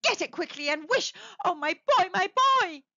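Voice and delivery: urgent, building to a high loud wail; panting, desperate. A narrator's reading. Mother longing for her dead son, desperate. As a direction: plead urgently, then cry out for the child. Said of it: "she panted".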